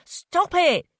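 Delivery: high loud plea; pleading. Acted. Jay pleading with Felicity to stop grabbing him. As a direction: beg her to stop. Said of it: "he pleaded".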